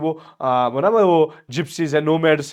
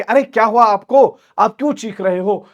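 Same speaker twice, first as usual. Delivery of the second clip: higher, louder alarmed question; alarmed father; 7 semitones higher, 6 dB louder than usual. Retold or imitated. Father Chubukov asking his daughter why she shouts, higher. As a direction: rush in asking what's wrong.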